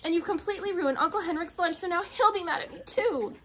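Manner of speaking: high, loud tearful wail; girl cries to the soldier about the ruined lunch; crying. A narrator's reading. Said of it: "she began to cry".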